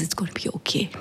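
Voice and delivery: hushed whisper, barely voiced; soft reassurance. Mother whispering comfort to her crying daughter, hushed. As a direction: soothe a sobbing child in a whisper.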